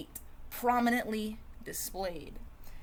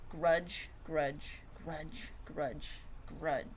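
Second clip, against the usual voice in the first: brooding mutter; 5 semitones lower, 6 dB quieter, much slower than usual. Acted. Brooding chant of grudge.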